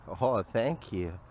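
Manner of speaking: ordinary delivery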